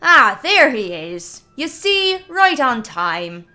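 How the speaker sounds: loud, slow, booming cheerful greeting; a big scarred man hailing the lad; hearty northern warrior. Acted.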